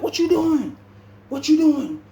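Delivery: put-on high, loud frantic older woman; panicked older lady. Female officer bursting in on the fight, high and frantic. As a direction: shriek it like a startled guard. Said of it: "She like".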